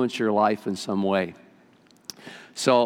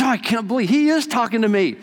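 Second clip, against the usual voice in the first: fed-up lady can't believe it; indignant disbelief; 9 semitones higher than usual. Acted. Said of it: "She says".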